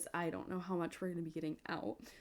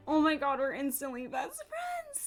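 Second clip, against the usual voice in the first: high squealed exclamation, falling at the end; overjoyed gushing; 5 semitones higher, 5 dB louder than usual. Real, not acted. Player bonding with her game puppy, overjoyed gushing. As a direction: burst out high, as if overcome. Answers own question about the puppy.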